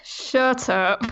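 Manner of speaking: laughing mock protest; laughing shut up. Real, not acted. Caught out about an unfinished game, laughing shut up. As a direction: laugh it off, embarrassed.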